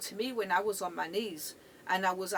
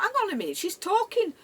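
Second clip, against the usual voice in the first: sudden realization; 10 semitones higher, 6 dB louder, faster than usual. Retold or imitated.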